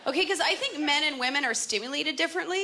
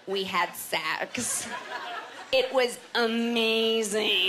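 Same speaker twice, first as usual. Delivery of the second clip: mock blissful daze; 2 semitones lower, much slower than usual. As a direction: draw it out, as if dazed by it. Mock account of amazing sex.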